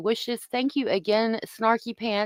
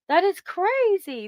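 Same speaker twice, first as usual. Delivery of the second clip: amazed; 11 semitones higher, slower than usual. Real, not acted. Reacting to member's eleven months.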